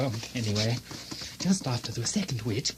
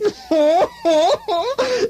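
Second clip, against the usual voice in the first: high sly cackle, much louder; sly laugh; 8 dB louder than usual. Acted. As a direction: cackle at the wicked idea. Laughs at the murder plot joke.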